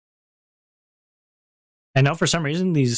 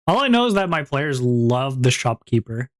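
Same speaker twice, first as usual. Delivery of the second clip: ordinary talk, nothing marked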